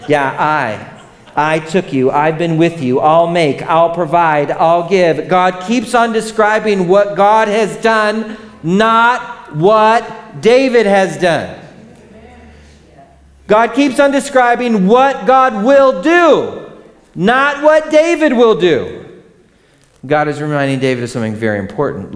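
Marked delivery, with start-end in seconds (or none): 0.1-5.3 each I'll punched harder and higher, building; emphatic God voice. Acted. Preacher voicing God, stressing each verb. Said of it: "Can you pick out the verb?". 5.3-19.0 loud, high, slow, stressing each word; preaching at full force. Shown as a demonstration. Preacher drives home the point to the congregation, loud and slow. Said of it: "I emphasized it there for you".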